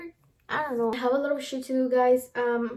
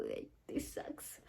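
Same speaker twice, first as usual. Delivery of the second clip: near tears, breathy and almost silent; tearful whisper; 15 dB quieter, slower than usual. Real, not acted. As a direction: let the words slip out under your breath, close to crying. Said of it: "why am I getting emotional".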